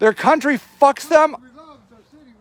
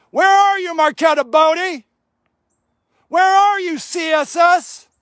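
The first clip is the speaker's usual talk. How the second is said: calling out; 6 semitones higher than usual. Real, not acted. Calling out for absent officials.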